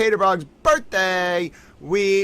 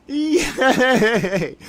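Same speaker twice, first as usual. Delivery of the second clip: laughing yay; 8 semitones higher than usual. Real, not acted. Laughing over the cake message.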